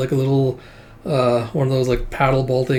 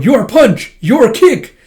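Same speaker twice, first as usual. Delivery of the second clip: much higher, louder shouted attack names; anime move callout; 10 semitones higher, 8 dB louder, much slower than usual. Acted. Fighter yells his moves while attacking. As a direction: shout each move as you strike.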